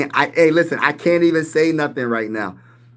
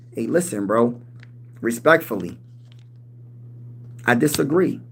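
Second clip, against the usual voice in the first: ordinary talk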